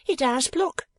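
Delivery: high-pitched, eager agreement; echoing sidekick. A narrator's reading. Second gentleman confirming to his partner, high echoing voice. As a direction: chime in high, backing up your partner.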